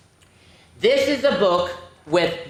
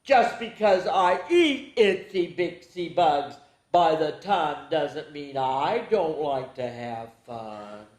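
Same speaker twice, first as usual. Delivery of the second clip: a put-on critter voice; mild storybook critter voice; character voice; 2 semitones lower than usual. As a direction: play the bug-eating creature. Reading a frog-like character line.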